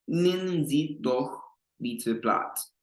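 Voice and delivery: slow repeat. Shown as a demonstration. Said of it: "repeating slowly for learners".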